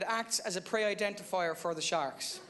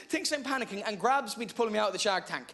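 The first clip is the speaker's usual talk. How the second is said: ordinary delivery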